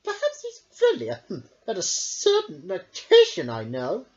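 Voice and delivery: put-on high, loud childish sneer; taunting king. A narrator's reading. King taunting Merrick, high and teasing. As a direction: needle him like a spoiled boy.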